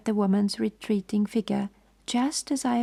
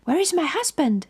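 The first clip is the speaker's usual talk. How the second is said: higher, alarmed question; frightened cry; 7 semitones higher, 3 dB louder than usual. A narrator's reading. The woman cries out for her husband, frightened and higher. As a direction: call out in a fright. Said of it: "she cried".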